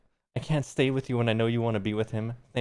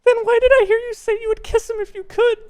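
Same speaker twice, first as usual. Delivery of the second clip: high, loud accusing put-on girl voice; hammed-up jealous girlfriend; 11 dB louder than usual. Reading Jen's jealous accusation aloud.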